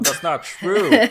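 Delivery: high, slow, loud protest; mock dramatic denial. Host jokingly denies twin confusion.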